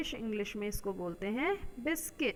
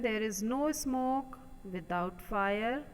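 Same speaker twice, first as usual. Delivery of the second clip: slow dictation; much slower than usual. Shown as a demonstration. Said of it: "reads the proverb slowly".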